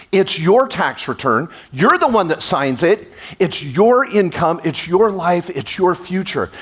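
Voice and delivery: each "your" leaned on, slow; stress on your. Real, not acted. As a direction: hit "your" in every line, slow and deliberate. Hammering ownership to audience.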